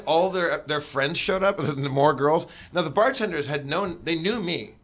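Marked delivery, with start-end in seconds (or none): none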